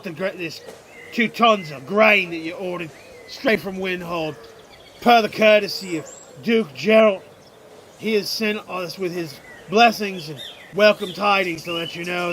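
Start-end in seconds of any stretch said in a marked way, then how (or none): none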